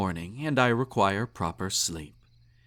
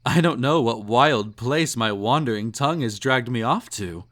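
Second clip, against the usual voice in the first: usual speaking voice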